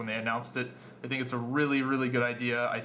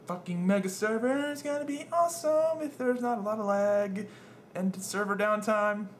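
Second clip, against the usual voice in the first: excited; 9 semitones higher than usual. Real, not acted. Hyped about mega servers.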